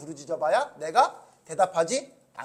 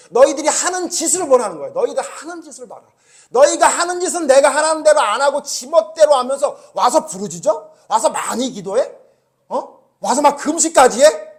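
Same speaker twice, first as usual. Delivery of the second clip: fiery rebuke sermon; 6 semitones higher, 7 dB louder than usual. Acted. Preaching God's rebuke forcefully.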